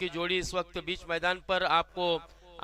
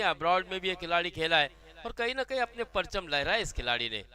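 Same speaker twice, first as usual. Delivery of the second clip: ordinary delivery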